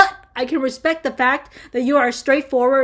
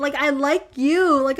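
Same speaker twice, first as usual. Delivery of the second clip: ordinary talk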